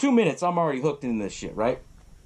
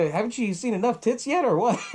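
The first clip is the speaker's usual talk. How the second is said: higher-pitched amused chiding; mock exasperation; 8 semitones higher than usual. Ben telling off his ogling friend, amused mock exasperation. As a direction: chide a friend, laugh creeping in.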